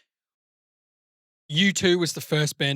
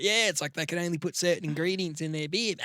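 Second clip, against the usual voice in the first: put-on New Zealand accent; 3 semitones higher, 4 dB quieter than usual. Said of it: "So does he sound like that?".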